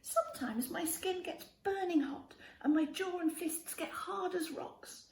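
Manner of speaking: ordinary delivery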